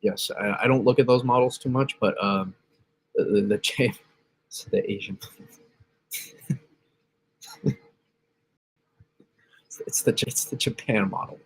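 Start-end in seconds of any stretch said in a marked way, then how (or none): 4.5-11.4 whispered through suppressed laughter; laughing. Real, not acted. Streamer on a weather stream stifling laughter, whispering. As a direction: giggle the words under your breath. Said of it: "Sorry, I shouldn't laugh".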